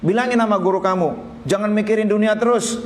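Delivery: a sage telling a student to stop chasing the world; Ibn Arabi's rebuke. Retold or imitated. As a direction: deliver the sage's blunt counsel up high. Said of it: "Apa kata Ibnu Arabi?".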